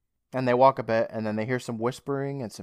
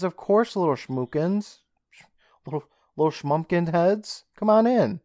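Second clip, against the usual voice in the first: sickly sweet, higher voice; creepy sweet Louise; 6 semitones higher, 4 dB louder than usual. Retold or imitated. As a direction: lure the kids in sweetly.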